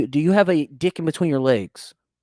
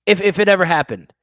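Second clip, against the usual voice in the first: normal talking voice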